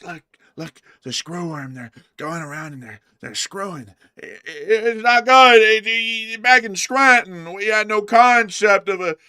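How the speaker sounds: rambling old politician impression. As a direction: ramble like a doddering politician, blurting lines out loud.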